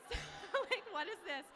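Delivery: high, loud, laughing incredulity; laughing disbelief. Retold or imitated. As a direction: laugh through your bafflement.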